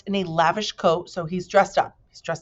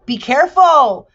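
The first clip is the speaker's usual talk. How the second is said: much higher, loud warning; shouting warning; 9 semitones higher, 12 dB louder than usual. A narrator's reading. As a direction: call out to stop them. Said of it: "shouted Milo".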